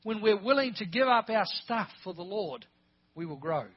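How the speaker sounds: loud, building pulpit delivery; emphatic preaching. Real, not acted. Preacher promising the church will grow, emphatic preaching. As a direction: press each condition home with force.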